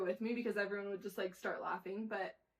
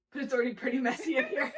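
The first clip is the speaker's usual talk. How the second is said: fast and louder, breaking into laughter; laughing quote; 3 semitones higher, 10 dB louder, faster than usual. Retold or imitated. Son's cheeky remark, laughing. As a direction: rush it then crack up. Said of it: "he goes".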